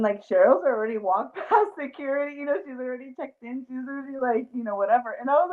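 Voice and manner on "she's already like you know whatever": ordinary talk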